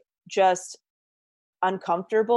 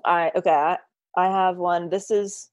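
usual speaking voice

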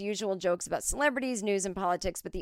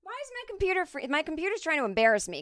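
high, quick exasperated whine; 9 semitones higher, faster than usual. Real, not acted. Host to her freezing computer mid-show, exasperated. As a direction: whine upward in rushed frustration.

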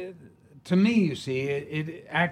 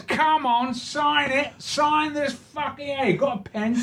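pushy, high-pitched Cockney demand; hammed-up Cockney pestering for an autograph; Cockney stranger; 13 semitones higher than usual. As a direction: badger them in a high Cockney voice. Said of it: "this Cockney comes in".

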